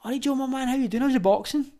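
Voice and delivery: high, casual matey greeting from a guy he knows; a high imitation of the local lad saying hello; cheery greeting. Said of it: "he's like".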